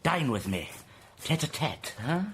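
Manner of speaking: normal talking voice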